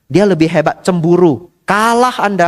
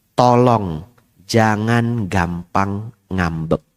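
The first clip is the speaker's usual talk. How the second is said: earnest plea; 9 semitones lower, 4 dB quieter, much slower than usual. Acted. Pleading advice, slow and low.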